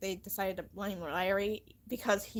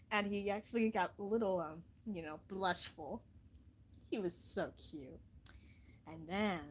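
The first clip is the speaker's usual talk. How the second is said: ordinary delivery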